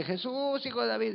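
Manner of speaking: clamor agudo fingido, más bien suave; grito imitado. Retold or imitated. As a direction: alarga el nombre como llamando, sin gritar. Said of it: "esta iba otra vez gritando". (grito imitado, alargado y agudo)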